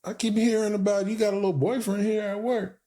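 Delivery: high, playful needling; teasing coworker about her work boyfriend. Retold or imitated. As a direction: poke fun at her gently. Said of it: "I was like".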